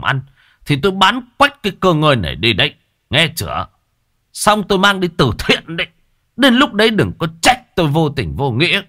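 jab a finger and rage at the wife; furious father scolding. A narrator's reading. Angry father blames his wife for spoiling the son, shouting. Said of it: "ông Bình lại càng sôi máu".

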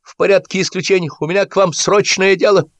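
high, pleading call-out; eager petitioner raising his voice; urgent calling. A narrator's reading. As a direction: call your request over the crowd. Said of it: "крикнул Стасик, протискиваясь к канату".

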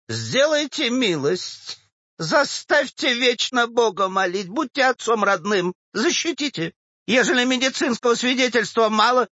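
high, wheedling supplicant; pleading old woman. Acted. Old woman begs the official for money, pleading. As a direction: beg insistently in a high, plaintive voice.

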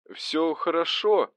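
slow, lifted reply; insisting all is well; high, drawn-out reassurance. A narrator's reading. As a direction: stretch it out in a high voice. Winded man reassuring his boss.

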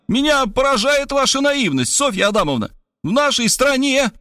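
high, preachy indignation; Robert lecturing her from the balcony. Acted. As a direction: start a pompous lecture.

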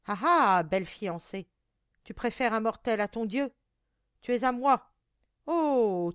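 loud, gloating monster tirade; strident monster voice. A narrator's reading. Monster claiming the girl as wife, strident loud gloating. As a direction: taunt your prey at full voice. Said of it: "de sa voix stridente et brisée".

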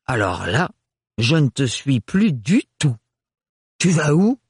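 put-on a stiff aunt lecturing a girl; prim aunt, disapproving. A narrator's reading. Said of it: "fut décontenancée".

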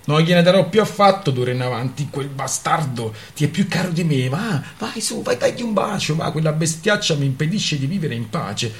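jealous outburst. Acted. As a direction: rage at the dog, bitter and jealous. Jealous outburst before tears.

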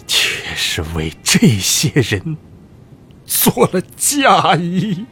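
rising into high, tearful sobs; bitter self-reproach through tears; tearful lament. Acted. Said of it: "扶着床沿哑声哭道". (含泪自责地哭诉)

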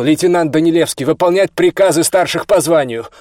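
loud, high military order; stern rebuke. A narrator's reading. Officer puts a junior in his place, sternly commanding. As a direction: cut him down with a forceful command. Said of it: "но тот его осадил".

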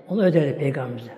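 ordinary delivery

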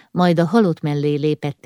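usual speaking voice

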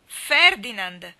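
very loud, drawn-out shout; shouted name. A narrator's reading. Mally cries out her lover's name, loud shout. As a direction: call the name out with all your strength. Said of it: "gridò Mally".

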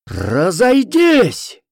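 loud, high, long drawn-out shout; barked order. A narrator's reading. Rescuer pushing into the crowd, loud barked order. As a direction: bellow it to clear a crowd. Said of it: "гаркнул я".

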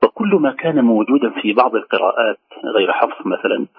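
usual speaking voice

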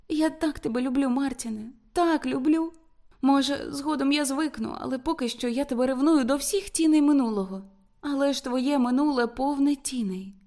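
high-pitched plaintive love confession. A narrator's reading. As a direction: plead pitifully, pitch held up. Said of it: "жалісно промовила вона".

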